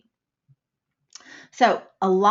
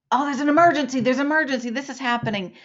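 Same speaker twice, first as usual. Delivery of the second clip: higher, louder alarmed rush; mock panic; 6 semitones higher, 4 dB louder, much faster than usual. Shown as a demonstration. Someone reports an emergency in mock alarm. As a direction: sound like the building is on fire.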